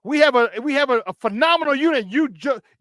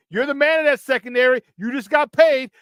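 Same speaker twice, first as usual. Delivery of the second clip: ordinary delivery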